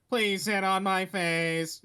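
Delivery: slow, loud robot voice. Retold or imitated. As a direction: drag it out like a robot. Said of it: "like he's doing robot noises".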